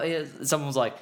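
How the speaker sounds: ordinary delivery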